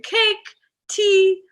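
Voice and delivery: put-on high, loud British-accented list; hammed-up British accent, dropping on the last item; British grandmother. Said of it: "speaking in the British accent".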